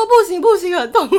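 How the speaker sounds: high loud laughing refusal. Retold or imitated. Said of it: "我说". (笑着拒绝)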